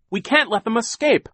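put-on high, loud boy's plea; urgent cry. A narrator's reading. Klaus fears villains will escape, crying out. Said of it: "Klaus cried".

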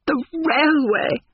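high, drawn-out gasp of amused shock; shrieky, slow, scandalised disbelief. A narrator's reading. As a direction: echo it aghast, voice leaping right up. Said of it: "gasped Miss Lavish".

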